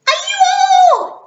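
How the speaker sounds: very high, loud startled yelp. Acted. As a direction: shriek in fright. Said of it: "yelped Minna".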